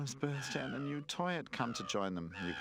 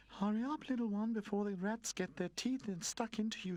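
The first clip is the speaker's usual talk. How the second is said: high, urgent warning call; 12 semitones higher than usual. A narrator's reading. Streetlight calling out to Pino, urgent. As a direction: hurry him away before the rats bite. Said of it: "she called to him".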